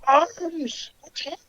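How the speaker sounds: high, small, faint creature cry; muffled distant yell. Acted. Game master voices enemy calling his arms, faint high yell. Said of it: "he yells out".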